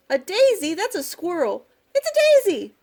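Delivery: high-pitched storybook squabble; bickering animals. A narrator's reading. Animals argue over the painting.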